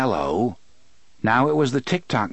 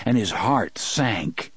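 usual speaking voice

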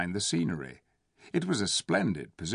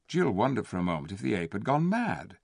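plain reading voice

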